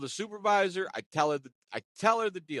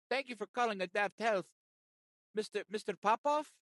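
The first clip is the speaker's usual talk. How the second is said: agent greeting; 6 semitones higher than usual. Retold or imitated. Agent answers the call.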